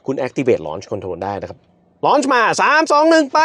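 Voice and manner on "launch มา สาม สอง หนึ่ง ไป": excited countdown. Real, not acted. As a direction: call the countdown with rising thrill. Launch countdown called out. (นับถอยหลังอย่างตื่นเต้น)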